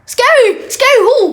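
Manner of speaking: high, loud indignant disbelief; mock indignant. Real, not acted. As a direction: scoff at the very idea. Outraged at friends calling mom scary.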